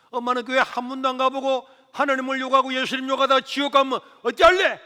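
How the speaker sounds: high, loud urgent plea; pleading shout. Retold or imitated. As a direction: beg her loudly to think of hell. Son pleading with mother.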